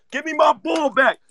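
a hint of a bullied kid crying for his ball; mock crying kid. Host imitating the crying kid from the movie, half-hearted sobbing yell. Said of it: "he remind me of dude".

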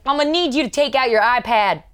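loud, high official demand; imitated security officer. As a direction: give the order like airport security.

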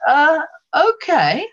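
slow, high, loud hesitant okay; hammed-up uneasy acceptance, pitched high; reluctant agreement. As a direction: drag out a comically doubtful yes. Reluctant reply to sudden request.

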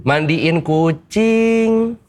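very slow, high, drawn-out "Mandiin kucing"; mocking drawl. Dad mocks cat-bathing hobby.

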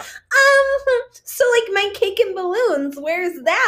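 high, drawn-out entitled whine; mock selfish roommate. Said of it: "when someone is so selfish".

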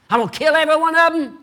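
fast, high, loud mock rage; hammed-up fury. Nehemiah's angry self venting about the nobles, fast and loud. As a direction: blurt the threat in a rush. Acted furious self threatening.

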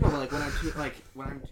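mic cutting out mid-sentence; call dropping out. Real, not acted. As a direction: audio drops to almost nothing. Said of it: "sorry, my mic cut out".

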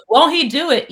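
high, loud, exuberant cry; whooping church-style proclamation; lively church exclamation. Acted. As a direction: call it out like a lively congregation. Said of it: "any church with a lively culture".

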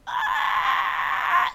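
loud, very high drawn-out squawk; long high-pitched wail of protest; exasperated cry. Real, not acted. Exasperated at accent remark.

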